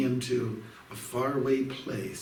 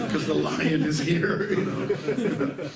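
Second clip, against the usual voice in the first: laughing; 6 semitones higher, 5 dB louder, much slower than usual. Real, not acted. Joking about scaring the devotees.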